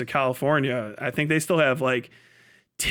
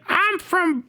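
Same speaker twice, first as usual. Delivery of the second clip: much higher dim desert local, proud of it; 14 semitones higher than usual. Retold or imitated. Hick starts answer.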